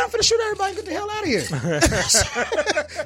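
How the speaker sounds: high punchline through a laugh; joking threat, laughing it out. Real, not acted. Barber laughing at his own punchline to the host.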